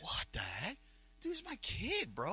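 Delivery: high outraged what the heck; indignant disbelief. Retold or imitated. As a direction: protest sharply in disbelief. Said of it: "I'm like".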